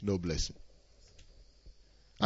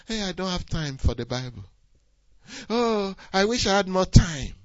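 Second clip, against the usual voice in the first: lightly mocking whiny excuse, lifting higher at the end; mocking excuse-maker; 9 semitones higher than usual. Pastor mimicking a churchgoer's excuses, mild mock whine. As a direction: poke fun at a lazy believer. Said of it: "How come we make excuses?".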